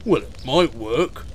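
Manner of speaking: slow, grudging fire engine; drawn-out reluctant agreement; sulky. Acted. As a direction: concede it slowly and grudgingly. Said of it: "said the fire engine sulkily".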